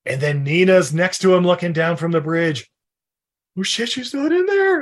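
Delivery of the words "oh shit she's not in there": shocked realization. Retold or imitated. Retelling movie scene, acted line.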